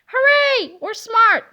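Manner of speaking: high, loud hooray; excited kids celebrating; cheering. Acted. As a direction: cheer like proud little kids.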